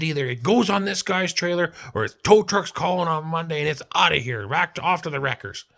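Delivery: retold boss ultimatum. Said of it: "this guy comes screaming up".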